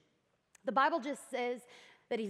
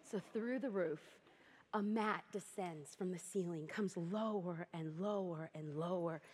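softer and lower with each lower; hushed; 7 semitones lower, 10 dB quieter than usual. Acted. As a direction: let the mat sink with your voice. Slow hushed descent imagery.

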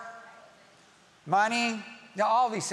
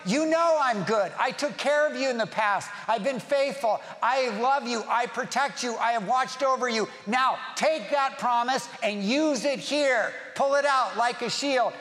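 raised, insistent preaching voice; impassioned exhortation; 5 semitones higher, 4 dB louder than usual. Acted. Jesus reminding his followers of his care, impassioned. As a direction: plead the case with force, point by point.

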